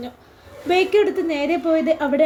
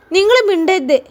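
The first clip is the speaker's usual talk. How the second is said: higher, louder angry snap at his wife; 5 semitones higher, 6 dB louder than usual. Acted. Uncle silences his worried wife, angry and loud. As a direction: cut her off in anger, pointing at her. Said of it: "ദേഷ്യത്തോടെ പറഞ്ഞു".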